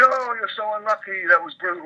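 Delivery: high-pitched mock commiserating; imitated commiseration. Other players commiserate over a bad beat, mock high-pitched sympathy. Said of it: "people were saying to me".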